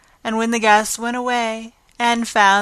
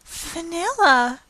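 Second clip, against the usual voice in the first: mortified answer; 9 semitones higher, slower than usual. Acted. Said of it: "said Anne, her face scarlet with mortification".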